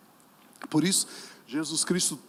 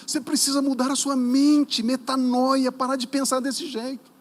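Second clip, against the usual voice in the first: loud, high pulpit command; forceful exhortation; 8 semitones higher, 8 dB louder than usual. Real, not acted. Preacher tells listeners to change their mind, loud high exhortation. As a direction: drive it home at full volume.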